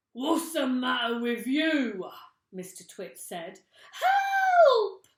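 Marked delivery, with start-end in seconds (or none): 0.1-2.1 loud, high gruff shout. Acted. Mister Twit yelling at his screaming wife. 3.9-5.1 shrill high scream; screaming for help. Acted. Missus Twit screaming at the thing in bed. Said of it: "screamed Missus Twit".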